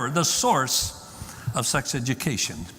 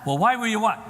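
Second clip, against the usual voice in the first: high, raised-voice demand, cut off; mock accusing; 8 semitones higher, 4 dB louder, faster than usual. Parent pouncing on son about what he watched, accusingly. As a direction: demonstrate the wrong, confronting way.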